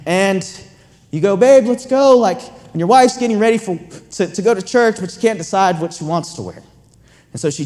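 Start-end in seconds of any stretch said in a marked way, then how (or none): 1.4-2.2 loud hurried call upstairs, pitched up. Acted. Husband calling his family to get moving for church, loud call. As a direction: hurry the household along, loudly.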